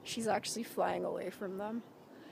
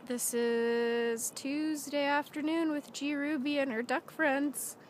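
put-on reading to a toddler; 4 semitones higher, 5 dB louder, slower than usual. Sing-song intro to toddler.

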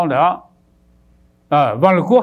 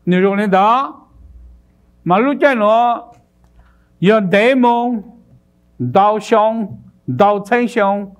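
rising preaching fervor; 6 semitones higher than usual. Real, not acted. Preacher building to a climax.

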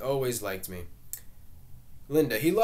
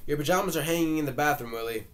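called up the stairs, louder, higher and fast; calling upstairs; 5 semitones higher, 5 dB louder, faster than usual. A narrator's reading. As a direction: call out to him in another room. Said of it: "calling upstairs".

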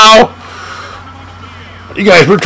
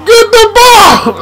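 laughing yell; 12 semitones higher than usual. Real, not acted. Begging for the ball.

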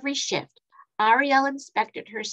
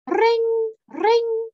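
very high, loud ringing; bell sound ring; 11 semitones higher, 5 dB louder than usual. A narrator's reading. Train bell sound effect.

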